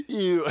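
high, loud squeal of disgust. Retold or imitated. Disgust at smelly kid.

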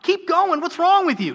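put-on high, loud scolding at a toddler; mock impatient parent. Parent scolding a toddler who fell, high mock nag. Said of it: "would you see them take two steps and fall and be like".

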